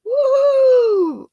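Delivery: high, loud woohoo of delight; thrilled high-pitched woohoo; excited cheer. Real, not acted. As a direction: celebrate the crowd with a whoop. Cheering fifty-nine viewers.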